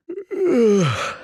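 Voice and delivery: a long, high weary sigh. Acted. Hero sighs alone before heading back to the bridge, high and weary. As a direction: let out a tired sigh before moving on.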